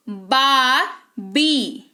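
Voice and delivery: बाबी very slowly as "baa... bee"; syllable demo of बाबी, "baa" then "bee"; two separated syllables. Shown as a demonstration. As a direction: split बाबी in two with a long gap. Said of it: "ये सारे दो syllable हैं".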